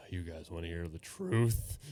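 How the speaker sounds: low, slow teacher about to reveal something; sigh, then drop low and slow, imitating; imitated teacher. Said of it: "and be like".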